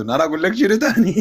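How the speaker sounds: words breaking into laughter; amused, laughing. Real, not acted. Co-host lost for words at the uncle's insult, laughing. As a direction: say it helplessly through a laugh.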